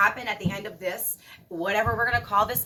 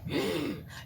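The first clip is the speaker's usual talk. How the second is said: angry gasp; 5 semitones lower, 5 dB quieter than usual. Retold or imitated. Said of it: "I'm like".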